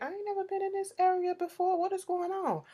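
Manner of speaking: high-pitched quoted bewilderment; imitating a bewildered man. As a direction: put on a high voice of mock alarm. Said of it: "Eve was like".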